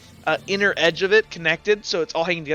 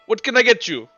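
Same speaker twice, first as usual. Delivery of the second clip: higher, louder counter greeting; shopkeeper character; 7 semitones higher, 7 dB louder than usual. Acted. Devin greeting customers from behind the counter, lightly voiced shopkeeper. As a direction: call out to the next customer. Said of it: "Devin's behind the counter goes".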